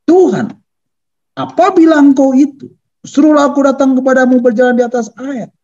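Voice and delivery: high, sustained call to the Lord. Acted. Peter answers Jesus from the boat, calling out. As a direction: cry it out as Peter, pitch held high. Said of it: "Petrus berseru dan menjawab dia".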